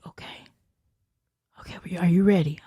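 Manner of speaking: fast urgent whisper; quick whisper. Retold or imitated. Husband whispering to her.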